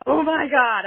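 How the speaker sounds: high, loud gasp of disbelief; shocked. Retold or imitated. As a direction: blurt it out, stunned. Toy answers, shocked reaction.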